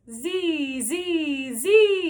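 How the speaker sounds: loud buzzing Z, rising on the last; buzzing Z vocal drill; buzzed Z. Shown as a demonstration. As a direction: buzz it with the tongue tip forward. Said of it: "Now on an E sound".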